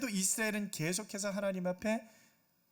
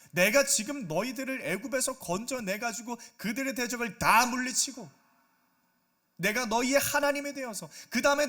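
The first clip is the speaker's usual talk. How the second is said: louder, rising rebuke of a wayward people; God rebuking; 3 semitones higher, 5 dB louder than usual. Acted. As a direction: scold them like an angry father betrayed. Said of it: "질책을 하고 계시는 거예요". (하나님이 백성을 꾸짖는 분노한 큰 목소리)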